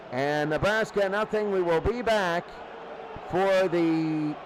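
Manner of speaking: ordinary talk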